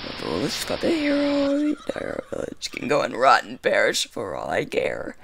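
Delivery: villainous witch sneer; scornful witch. Acted. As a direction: spit contempt at the hero. Voices the witch's contempt.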